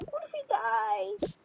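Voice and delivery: high, slow wail of dread; overdramatic fear. Pretend. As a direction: draw it out in melodramatic panic. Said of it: "stop being so dramatic".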